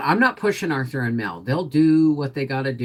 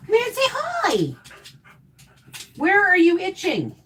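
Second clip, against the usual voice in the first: high, sing-song pet voice. Real, not acted. Baby-talking to her dog.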